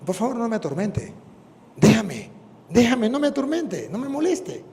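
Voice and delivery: pleading demon. Retold or imitated. El demonio suplica.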